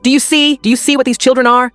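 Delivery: very high-pitched outraged accusation; scandalized grandmother, pitch soaring; shrieking. A narrator's reading. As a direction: rail at everyone in a high shriek. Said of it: "she shrieked".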